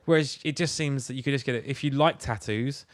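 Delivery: ordinary talk, nothing marked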